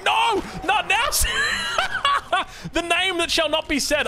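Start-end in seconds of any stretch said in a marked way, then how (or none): 0.0-1.0 mock shout. English viewers begging him not to say it, mock shout. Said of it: "All the English people are like". 1.1-2.7 high real laugh with a shout. Real, not acted. Caster laughing after mocking English viewers, shouted laugh.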